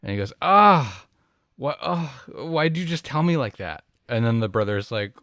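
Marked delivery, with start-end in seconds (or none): 0.4-3.8 aghast brother in a joke, pitched up; upset brother. Acted. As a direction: play the shocked brother who just heard his cat died. Brother told cat died.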